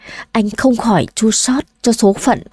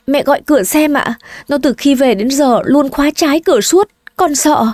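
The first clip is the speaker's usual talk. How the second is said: higher, anxious daughter-in-law voice; frightened plea; 5 semitones higher than usual. Acted. Daughter-in-law begging mother-in-law to check the door, high and anxious. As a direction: beg your mother-in-law, fear rising.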